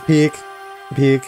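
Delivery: usual speaking voice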